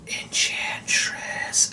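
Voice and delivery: whispered, drawn out very slowly; eerie whisper. Pretend. Reviewer whispering the Enchantress name spookily. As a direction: breathe the name like a spell.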